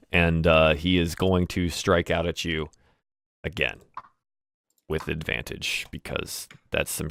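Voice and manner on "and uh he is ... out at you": ordinary talk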